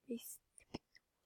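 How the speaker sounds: very soft, voiceless aside; whisper. Real, not acted. Player finding the snipping tool, whispering to self. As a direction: whisper it to yourself.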